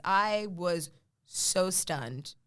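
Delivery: slow and drawn out; drawn out in awe. Real, not acted. Remembering seeing her credit, slow and awed. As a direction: stretch each word in amazement. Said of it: "said twice, first drawn out".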